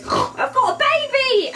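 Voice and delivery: high-pitched gasping exclamation; gasp, then squeal the news slowly; mock shock. Imitating a celebrity mother finding her baby, mock shocked. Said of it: "well shocked".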